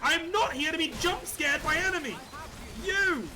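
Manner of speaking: high, loud panicked shout; startled shouting. Real, not acted. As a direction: yell out after a scare. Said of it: "Motherfuckers jump scare me".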